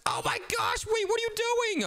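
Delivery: high, yelping alarm; excited yell. Real, not acted. Gamer yelping at his sister as he loses, high excited yell. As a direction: shriek it as the game turns. Losing the game, yells at screen.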